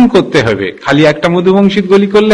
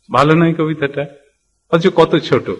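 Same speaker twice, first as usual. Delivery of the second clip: ordinary talk, nothing marked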